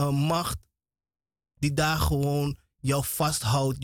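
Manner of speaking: normal talking voice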